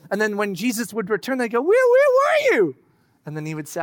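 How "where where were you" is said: much higher, louder, pleading question; anxious disciples. Retold or imitated. Disciples to returning Jesus, high anxious calling. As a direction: call out to him with worried relief.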